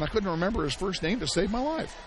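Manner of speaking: usual speaking voice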